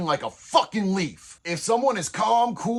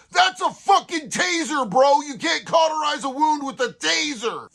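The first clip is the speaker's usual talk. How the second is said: incredulous shout; 6 semitones higher than usual. Real, not acted. Narrator yells in disbelief at his homeboy's taser plan. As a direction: yell it like it's the dumbest idea ever.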